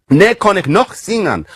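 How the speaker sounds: put-on reconstructed Old English accent with a Germanic lilt; half-invented Old English accent. Shown as a demonstration. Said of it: "I don't know what accent this is".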